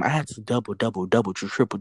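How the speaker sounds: ordinary delivery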